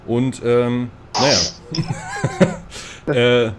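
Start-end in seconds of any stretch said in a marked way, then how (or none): 1.4-3.0 breathy giggle at her own clumsiness; embarrassed laugh. Real, not acted. Telling friends about burning her finger, sheepish laugh.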